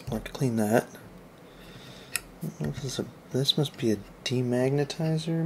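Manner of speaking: ordinary delivery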